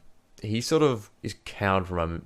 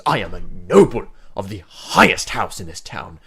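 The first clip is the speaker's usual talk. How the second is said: loud, proud command; a lordly guard ordering them off; haughty noble guard; 9 dB louder than usual. Acted. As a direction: pull rank on them with a puffed chest. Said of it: "puts his hands on his chest and goes".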